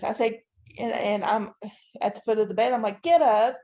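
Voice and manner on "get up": raised, higher urging to get up; urgent retold call. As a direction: call out as if rousing someone collapsed. Urging her collapsed husband to rise.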